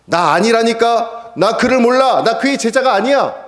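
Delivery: high, loud insistent denial; emphatic denial. Acted. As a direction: push the denial away hard. Peter denies Jesus.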